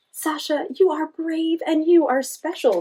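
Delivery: bright praise for a friend; Joy's voice. A narrator's reading. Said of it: "said Joy".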